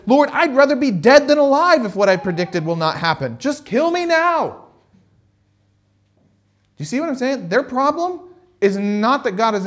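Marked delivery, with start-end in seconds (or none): 0.1-4.6 hammed-up despairing prophet; melodramatic Jonah. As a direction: beg for death like a sulking drama king. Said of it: "And so he says".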